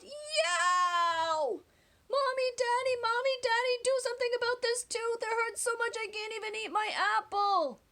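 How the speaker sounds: put-on high, whiny child crying out in pain; childish yell. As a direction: wail like a little boy with a sore tooth. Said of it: "and yelled".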